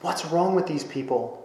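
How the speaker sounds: usual speaking voice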